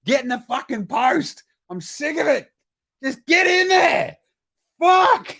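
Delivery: furious coach barking at his player; angry coach rant. Retold or imitated. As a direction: build from gripe to explosion. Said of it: "Ime be like".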